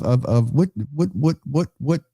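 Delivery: stuck repeating what, high and tumbling; stuttering. Real, not acted. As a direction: trip over the word what again and again. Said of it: "I'm stuttering".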